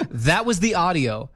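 normal talking voice

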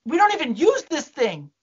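whiny, fed-up mock protest; exasperated complaint. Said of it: "I'm like".

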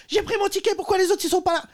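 imitation haut perchée d'une femme qui gueule; voix très aiguë de râleuse qui proteste; cri de passagère imité. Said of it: "la meuf qui gueulait en disant". (râleuse qui gueule, imitée)

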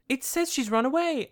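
high, loud melodramatic dismay; mock alarmed Queen. Retelling the Queen reading her daughter's letter, mock alarm. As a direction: cry it out in pretend shock.

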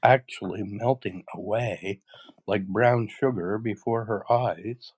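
usual speaking voice

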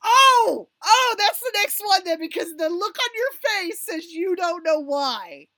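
high, loud gleeful realization; real, thrilled exclamation; excited outburst. Real, not acted. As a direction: burst out loudly, pitch jumping way up. Delighted shock at friend's ignorance.

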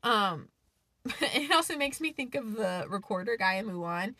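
ordinary delivery

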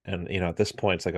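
ordinary talk, nothing marked

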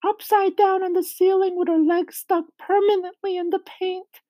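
very high squeaky spider voice; high-pitched Miss Spider, frightened by the memory; Miss Spider, distressed. Acted. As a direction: tell the upsetting tale in a tiny, high voice.

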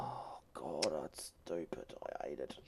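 very soft, nearly whispered grumble; muttered under breath. Real, not acted. To himself about GarageBand, soft disgusted mutter. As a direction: grumbles it to himself, barely voiced.

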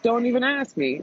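fed up, "ask" pitched high then dropped; emphatic refusal. Retold or imitated. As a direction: snap it out high and final.

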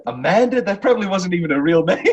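high, loud, incredulous reply; worked-up movie outburst. Acted. Quoting a film exchange about Amanda, high and distressed. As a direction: burst out high, getting worked up.

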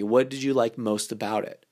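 ordinary talk, nothing marked

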